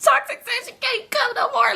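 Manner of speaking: very high, exaggerated crybaby whine; mock near tears. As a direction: whine it to a sister as if about to cry. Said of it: "So I'm about to cry".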